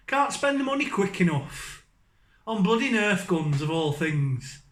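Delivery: ordinary talk, nothing marked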